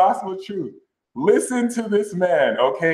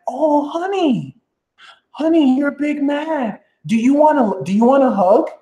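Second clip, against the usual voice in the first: mock sweet pity; 10 semitones higher than usual. To a hater, syrupy mock-sympathetic cooing. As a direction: coo at them like a fussing aunt. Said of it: "Look at them and be like".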